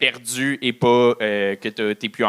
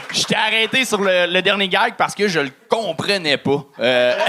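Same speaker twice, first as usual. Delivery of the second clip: ordinary delivery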